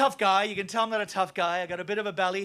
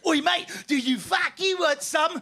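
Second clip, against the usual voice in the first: higher, slower mock Cockney taunt; drawn-out London thug shout; London hooligan challenge; 6 semitones higher, slower than usual. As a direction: goad them like a London yob. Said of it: "Four big fat football hooligans".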